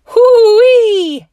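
very high, very loud whoop; whooping yell. A narrator's reading. Dad hit by a snowball, whooping. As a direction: let out a wild whoop. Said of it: "yelled Dad".

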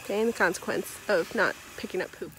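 ordinary talk, nothing marked

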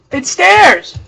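loud, high burst of delight; excited shout. Real, not acted. Gamer spotting stairs, shouting with joy. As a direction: yell it out, thrilled to find the stairs.

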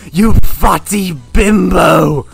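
loud, slow, drawn-out yell; furious shouted insult. Acted. Host insults Noodle, shouting furiously. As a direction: shout each word long and hard.

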